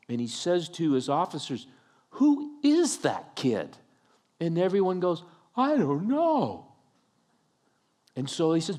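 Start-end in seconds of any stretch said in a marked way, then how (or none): 2.1-3.8 high, slow, amazed demand; astonished question. Retold or imitated. Saul astonished, asks his officers. 5.5-6.7 high, drawn-out mock cluelessness; clueless. Officers acted out, clueless.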